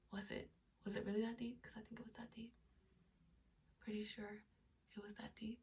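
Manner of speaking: unvoiced whisper to the camera. Real, not acted. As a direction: whisper it like a secret. Confiding aside to viewers.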